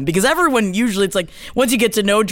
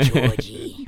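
cooing at a dog; 10 semitones lower, 5 dB quieter than usual. Acted.

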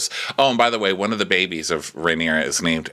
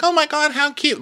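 very high cooing imitation; high-pitched mock gushing; gushing grandparent. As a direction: coo over a baby like a besotted grandparent. Grandparent cooing over a baby.

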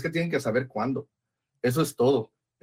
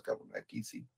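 unvoiced aside; 3 semitones lower, 16 dB quieter than usual. Real, not acted.

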